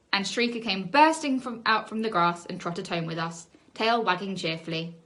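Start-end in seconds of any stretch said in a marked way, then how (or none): none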